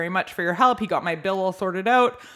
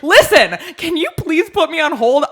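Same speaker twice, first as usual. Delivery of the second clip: higher, much louder fed-up yell; 6 semitones higher, 8 dB louder than usual. Retold or imitated. Caller yelling at the typing agent, loud and fed up. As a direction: snap at him to stop typing, full volume. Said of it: "I was actually yelling at this guy".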